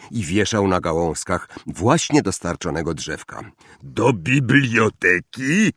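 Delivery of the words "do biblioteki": slow, drawn-out question with rising end; surprised. A narrator's reading. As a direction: echo it in puzzled disbelief. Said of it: "zdziwił się Hagrid". (przeciągłe, zdziwione pytanie)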